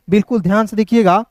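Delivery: ordinary talk, nothing marked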